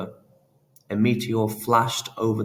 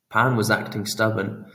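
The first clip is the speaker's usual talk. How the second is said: plain reading voice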